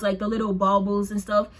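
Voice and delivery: normal talking voice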